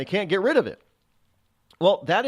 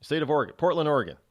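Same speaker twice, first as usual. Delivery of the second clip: ordinary talk, nothing marked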